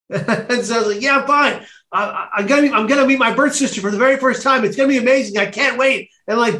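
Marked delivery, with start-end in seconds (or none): 1.0-6.1 high, rushing, eager gush; retold excitement. Speaker to police before meeting birth sister, bubbling with excitement. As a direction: gabble it out higher, as if unable to wait. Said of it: "I just am so excited".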